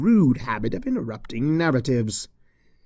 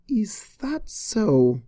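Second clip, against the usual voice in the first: Cheerilee, breathy and doubtful; 9 semitones higher, much slower than usual. Acted. Cheerilee voiced.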